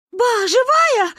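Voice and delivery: high, loud slow cry; tearful shout. A narrator's reading. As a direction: shout through tears. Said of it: "крикнула ... Маня". (крик сквозь слёзы)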